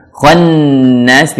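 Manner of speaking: "Khannas" with a long held N; lengthened nun. Shown as a demonstration. Teacher showing gunnah to learners, "Khannas" with lengthened N. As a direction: hum on the doubled N of "Khannas". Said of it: "Jadi nunnya dilamakan".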